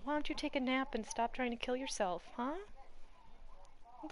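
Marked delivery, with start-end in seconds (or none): none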